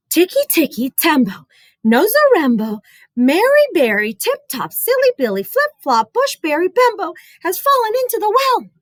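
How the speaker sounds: put-on high rushed boy, out of breath; breathless boy. Chen out of breath telling his mother, high rushed voice. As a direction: rattle the long name off, high and hurried. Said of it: "Chen took a deep breath and said".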